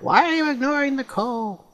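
high mock whiny viewer voice; imitating viewers. Gamer imitating viewers nagging about coal, high mock voice. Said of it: "People are gonna yell at me".

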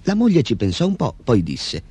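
ordinary delivery, nothing marked

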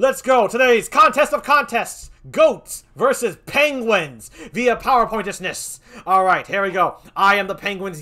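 loud, punchy game-show opener; hype announcer. Acted. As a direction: pump up the crowd like a host.

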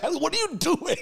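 high-pitched imitation of a scolding mom; hammed-up parental disbelief; mock appalled mom. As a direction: squeal it like a dismayed mother. Imagined mom reacting to his hair.

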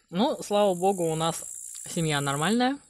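words through a bite; speaking mid-bite with chopsticks; biting food while talking. Real, not acted. Seen 1: woman bites greens off chopsticks at 0.2-1.2, then chews.

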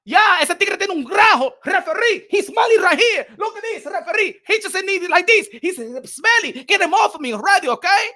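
exasperated in-ring griping; annoyed boxer. Acted. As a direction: protest like a boxer being smothered. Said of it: "if I was Limonada".

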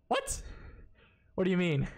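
squeaky shocked what; surprised. Real, not acted. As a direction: caught off guard, very high. Shocked by rude man.

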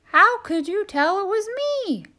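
high, fast and baffled; surprised turkey voice. Acted. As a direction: blurt it out.

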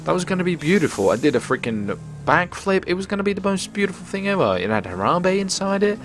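high, quick protest; worked-up, high-pitched gamer whine; indignant lament. Real, not acted. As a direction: complain that your big moment was ruined. Complains about ruined backflip.

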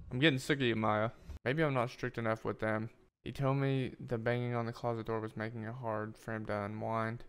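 ordinary talk, nothing marked

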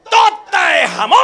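high, drawn-out mock-Chinese order; mock Chinese diner. Chinese diner answers on Tuesday, high drawn-out mock-Chinese cry.

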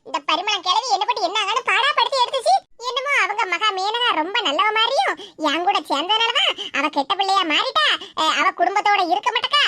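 ordinary talk

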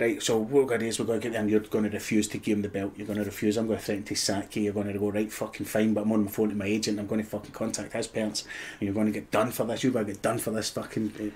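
hushed plotting. Retold or imitated. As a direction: keep it down so the kids can't hear. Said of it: "And he's like".

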